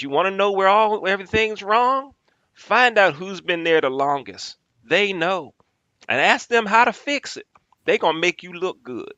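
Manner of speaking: high, loud Southern boss advice; Southern accent. Retold or imitated. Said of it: "I'm gonna fake a Southern accent".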